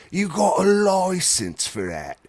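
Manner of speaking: slow, high, laughing British copper voice; mock British cop again. Host repeating his British cop bit for laughs, slow and high. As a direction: drag out the bobby's question through laughter. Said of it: "that's how I imagine they would say".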